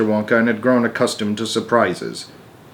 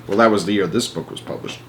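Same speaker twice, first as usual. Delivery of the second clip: ordinary delivery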